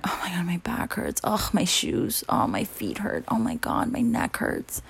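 whispered mock complaining; a whispered imitation of a whiner; mock whiny whisper. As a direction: moan about aches under your breath, mocking. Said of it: "you'd bitch and moan".

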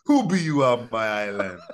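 hostile mock Jamaican challenge; crazy Jamaican accent. Castaway challenging rescuers on the beach in mock Jamaican. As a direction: bark it like a territorial islander. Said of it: "crazy Jamaican accent".

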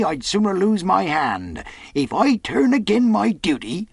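hoarse sailor, voice shaking like a taut rope; hoarse shaky voice. Acted. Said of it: "spoke as hoarse as a crow, and his voice shook too".

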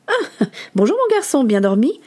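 bright, high-pitched opening on a good morning; chirpy good-morning voice; cheery morning greeting. Acted. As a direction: greet a sleepy boy cheerfully.

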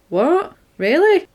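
high-pitched incredulous questions; mock disbelief. Man told to get ready, mock-incredulous. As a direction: imitate someone taken aback, pitch jumping up.

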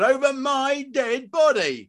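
very high, slow furious refusal; drawn-out outraged ultimatum; bellowing. Acted. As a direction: bellow it word by word. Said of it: "He bellowed".

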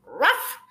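a sharp little woof; playful fake dog bark; mock dog bark. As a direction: bark like a dog to scare him. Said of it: "I just went".

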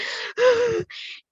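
high startled gasp; sharp gasp. Retold or imitated. Gasp imitating breakdown.

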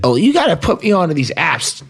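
ordinary talk

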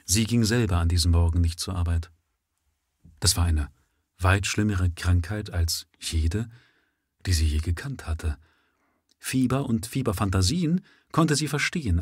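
ordinary delivery, nothing marked

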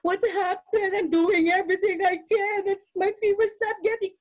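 high, tearful sobbing quote, voice breaking; crying in the shower. Retold or imitated. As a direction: cry it out in frustration, pitch up. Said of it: "I'll be crying".

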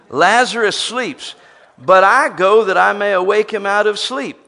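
loud, high proclamation of Jesus's words; declaiming scripture. Acted. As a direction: preach it out full voice.

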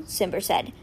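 ordinary delivery

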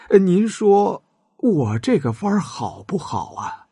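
wheedling courtier pitching his plan; fawning adviser. A narrator's reading. Adviser pitching the beacon trick to the king, fawning.

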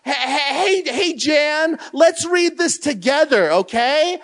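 high, bright call to the congregation; cheery greeting. Retold or imitated. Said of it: "and I say".

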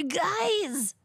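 very high, strained weird comic voice; Bobcat Goldthwait. Acted. Attempting a requested impression for the co-host, weird comic voice. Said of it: "do the Bobcat Goldthwait, uh, voice".

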